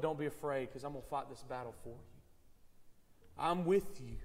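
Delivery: gentle reassurance. Retold or imitated. Pastor voicing the Lord to Joshua.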